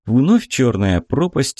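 ordinary delivery

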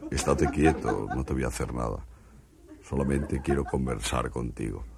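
calm the frightened man as an alien. Acted. Martian speaking to a frightened stutterer in a low alien voice.

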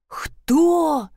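startled high yelp; scared, voice jumps up; frightened. Acted. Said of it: "испугался толстяк". (испуганно)

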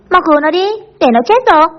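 high, urgent plea for help; clamoring in alarm. A narrator's reading. Boys clamoring to save their drowning friend, alarmed. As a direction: raise the pitch as if panicking. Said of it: "nghe bọn thằng Sún nhao nhao".

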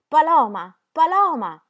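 very loud, high desperate calls; a child crying out for Paloma; loud calling. Acted. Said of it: "gridando a gran voce". (richiamo disperato a gran voce)

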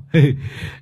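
a short high cackle at his own joke; laughing at own joke. Acted. Long laughing at his joke about the bald owner, high cackle. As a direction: laugh at your own tease.

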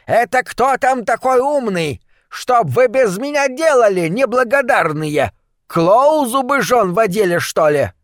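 put-on high, scolding old woman's cry; high-pitched village crone telling everyone off; indignant old woman. A narrator's reading. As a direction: shout back like an affronted granny. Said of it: "Круглолицая опрятная старушка упёрла в бока кулаки и прокричала".

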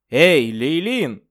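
loud high call across a field. Acted. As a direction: shout it out to someone far off. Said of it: "крикнул Джордж".

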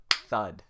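loud spoken thud; a loud thud effect; thud sound. Pretend. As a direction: punch it out like an impact. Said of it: "That was the thud".

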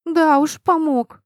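loud, high sarcastic comeback; annoyed retort. A narrator's reading. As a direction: throw it back with scornful irritation. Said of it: "только слегка злил".

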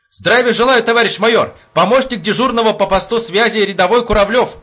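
high, loud soldier's salute; eager military report. Acted. Young private reports to two officers, loud and formal. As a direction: shout the report standing to attention.

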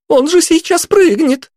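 put-on high squealing teen girl; giddy party girl. A narrator's reading. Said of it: "взвизгнула девчонка".

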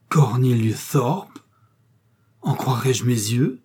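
slow, drawn-out astonishment; stunned disbelief. A narrator's reading. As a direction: say it as if seeing a ghost. Baronnet recognises a dead friend.

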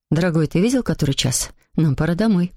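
low-voiced hint to leave; mother to husband. A narrator's reading. Mother hints to father it's time to go, pitched low.

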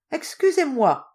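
high, loud mock-polite cry; exclaiming. Colcravate crying out to the old woman, high and loud. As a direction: call out with exaggerated politeness. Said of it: "s'écria Colcravate".

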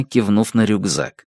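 ordinary delivery, nothing marked

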